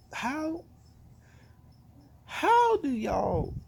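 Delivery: high and slow, drawn out in disbelief; exasperated. Real, not acted. Fan asking the bookers, exasperated. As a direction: stretch it out, fed up. Frustrated at the booking.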